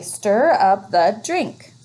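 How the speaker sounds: teacher modelling slow reading; slow reading aloud. Shown as a demonstration. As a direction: Stir up the drink read slowly. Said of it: "Let's read it smoothly".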